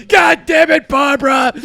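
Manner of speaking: hammed-up husband yelling; angry shout. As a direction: yell her name in frustration. Shouting imitation.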